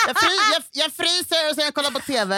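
high, laughing mimicry; amused, high put-on voice; imitating co-host.

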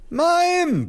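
very high, drawn-out squeal; muffled mother. A narrator's reading. Mother stopping father with spirits, high muffled wail. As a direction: wail it through a swollen mouth. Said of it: "blickte meine Mutter".

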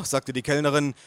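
plain reading voice

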